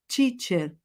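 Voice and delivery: teacher with a "tchee" start, like Brazilian tia; wrong teacher. Shown as a demonstration. Teacher to students as the common mistake, with "tchee". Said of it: "muita gente fala".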